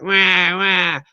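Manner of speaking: high whining wah wah; imitating her fit in the background; mock crybaby wails. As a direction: whine it like a spoiled toddler. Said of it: "You can hear her in the background".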